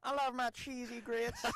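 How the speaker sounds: high, drawn-out mock Southern accent; Reeves Jackson. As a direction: exaggerate a colleague's Southern sound, slowly. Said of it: "you come in there and you're like".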